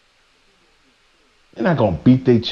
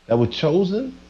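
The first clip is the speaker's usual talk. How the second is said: normal talking voice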